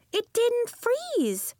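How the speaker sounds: high, slow, savoring the win; a girl repeating her triumph slowly; drawn-out delight. A narrator's reading. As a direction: stretch it out in happy disbelief.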